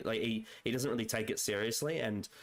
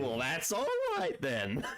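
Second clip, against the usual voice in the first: higher, slow, amused villain line; mock Toymaker; 6 semitones higher, slower than usual. Quoting the Toymaker to a fellow fan, slow amused lilt. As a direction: draw it out with a laugh as the Toymaker. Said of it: "And then he's like".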